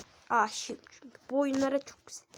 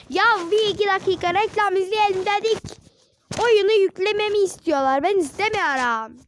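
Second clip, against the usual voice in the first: higher, louder whiny protest; loud complaint; 6 semitones higher, 8 dB louder than usual. Real, not acted. Kid gamer protesting ads to viewers, high and loud. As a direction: complain loudly to the camera.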